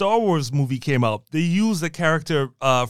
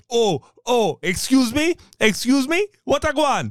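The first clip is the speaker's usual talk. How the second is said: mock Caribbean accent; 7 semitones higher than usual. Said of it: "a heavy Caribbean accent".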